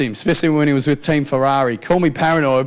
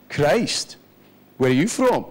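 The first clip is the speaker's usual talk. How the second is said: lightly imitated Scottish accent; Scottish working-class man; slower than usual. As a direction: play a baffled Scottish truck driver. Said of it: "working class guy pulls over".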